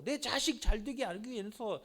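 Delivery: ordinary talk, nothing marked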